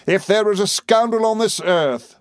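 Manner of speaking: high, ringing denunciation; utmost vehemence. A narrator's reading. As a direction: break out in vehement accusation. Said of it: "suddenly breaking out again with the utmost vehemence".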